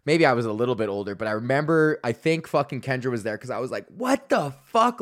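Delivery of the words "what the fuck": high, slow retold shock; shocked disbelief. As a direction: act out being appalled, pitched way up. Retells shock at horror movie.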